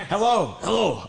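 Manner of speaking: loud bright puppet hello. Acted. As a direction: call out as a cheery puppet. Puppet greeting crowd loudly.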